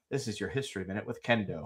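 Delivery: lightly playing a history show host introducing himself; understated mock announcer; mock TV host intro. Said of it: "I can just be like".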